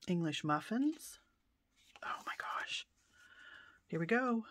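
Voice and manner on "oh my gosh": whisper. Real, not acted. Awed at old cookbook.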